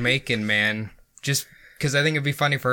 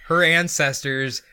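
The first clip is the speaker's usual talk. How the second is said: ordinary delivery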